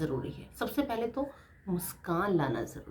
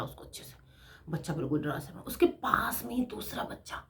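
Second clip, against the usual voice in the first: whisper; 6 dB quieter than usual. Real, not acted. Telling of a frightened child.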